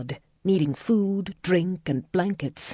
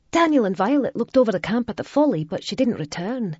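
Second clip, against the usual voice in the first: put-on 1 girl's voice; 6 semitones higher than usual. A narrator's reading. Gavina goes on speaking.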